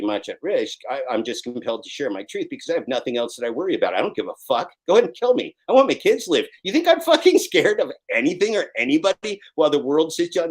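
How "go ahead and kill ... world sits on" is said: loud, high, defiant outburst; raised voice daring them to try; defiant shout. Real, not acted. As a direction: yell it at whoever threatens you. Defiant outburst about not fearing death.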